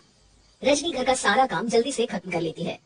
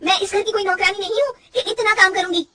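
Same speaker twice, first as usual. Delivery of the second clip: much higher, louder defiant shout; angry outburst; 9 semitones higher, 5 dB louder than usual. Acted. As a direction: refuse to be treated as a maid. Daughter-in-law shouting back. (गुस्से में चिल्लाकर जवाब)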